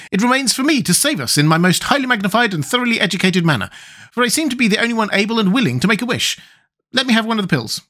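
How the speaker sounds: high, self-important character voice; Wogglebug, pompous. Acted. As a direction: proclaim yourself the learned saviour, high-pitched.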